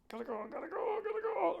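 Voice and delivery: put-on high, urgent little kid pleading softly; urgent child. Kindergartner begging the teacher to leave, high and urgent. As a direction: plead like a desperate small kid. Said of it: "I'm just like".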